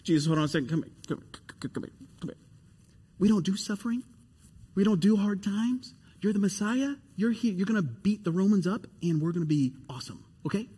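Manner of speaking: hushed, pulling him aside; a quiet hint of scolding Peter; Peter rebuking Jesus. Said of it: "Peter's like".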